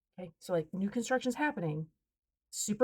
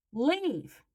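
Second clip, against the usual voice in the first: curt order; 7 semitones higher than usual. Retold or imitated. Final order to trespasser.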